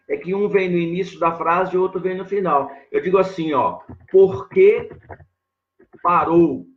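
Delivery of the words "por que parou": teacher reading a question for students; asking tune. Shown as a demonstration. Teacher demonstrates the question to the class with a rise on Por. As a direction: question said out loud, rising on Por. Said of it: "Faço uma pergunta".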